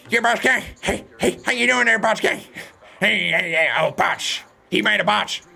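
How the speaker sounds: high, loud excited streamer voice; Botch guy impression. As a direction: imitate the Botch guy's hyped delivery. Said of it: "it's my impression of the Botch guy".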